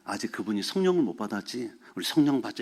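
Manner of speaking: quiet, reassuring acceptance; calm understanding. Retold or imitated. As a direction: settle it down kindly. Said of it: "이렇게 가, 말이".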